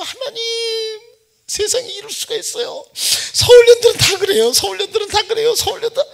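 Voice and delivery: high tearful plea; crying prayer. Retold or imitated. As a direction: plead with God through tears. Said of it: "그냥 산에 가고". (높은 목소리로 울먹이며 하소연)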